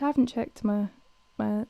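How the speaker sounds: usual speaking voice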